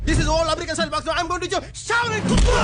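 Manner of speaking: slow, high, loud hammed-up announcement; grand proclamation. Fake kung fu master to new student, slow hammed-up shout. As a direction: boom it out like a showy martial arts master.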